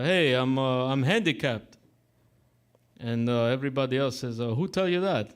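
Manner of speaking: lightly imitated Russian-Italian accent; mock Russian-accented joke delivery. Barber giving the joke's punchline, mock Russian accent. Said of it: "he's got this Russian accent".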